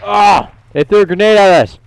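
sudden high yell of alarm; alarmed shout. Real, not acted. Under grenade fire in game.

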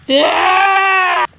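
loud high yeah; sarcastic triumphant yell; shout. Real, not acted.

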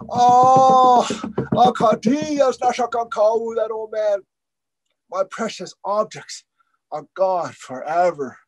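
put-on old man crying over his stolen daylight; crying lament. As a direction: wail the loss, then sink. Old man cries at loss.

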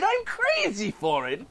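laughing through the words; villain's gleeful cackle; sly laugh. Acted. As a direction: chuckle with glee.

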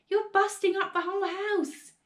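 high, protesting shout; calling back. A narrator's reading. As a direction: protest up at her in alarm. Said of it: "George called back".

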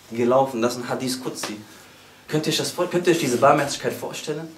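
ordinary talk

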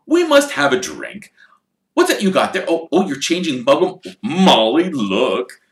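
put-on childlike fascination; excited.

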